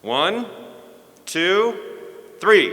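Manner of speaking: high, loud countdown to a cheer; loud count-in. Real, not acted. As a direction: call the count out, building in force. Counting audience into a shout.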